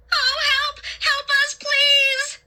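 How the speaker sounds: very high, frantic plea; panicked high-pitched screaming; desperate cry for help. A narrator's reading. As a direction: beg for rescue in a high shriek. Narrator cries out amid bats.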